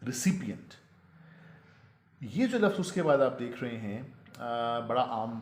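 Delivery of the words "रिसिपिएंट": रिसिपिएंट as ri-SIP-i-ent, falling; correct pronunciation. Shown as a demonstration. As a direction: model रिसिपिएंट on its own. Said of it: "असल में जो इसका तलफ़ुज़ है". (रिसिपिएंट, "सिपि" साफ़ बोलते हुए)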